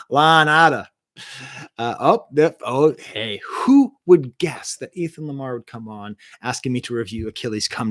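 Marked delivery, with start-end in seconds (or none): 0.1-4.7 loud, broad Boston accent. Shown as a demonstration. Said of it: "in a Boston accent".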